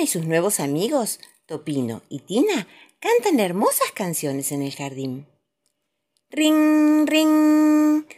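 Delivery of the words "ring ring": high, loud ring ring; ringing bell imitation. A narrator's reading.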